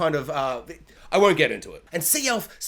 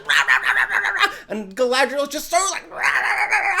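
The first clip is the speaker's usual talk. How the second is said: mock screaming; 6 semitones higher than usual. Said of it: "looking at each other, just being like".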